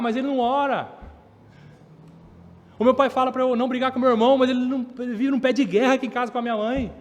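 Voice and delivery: put-on a kid listing what dad never does, in a quick, high outburst; complaining child.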